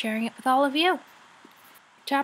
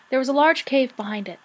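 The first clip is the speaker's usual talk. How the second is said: ordinary delivery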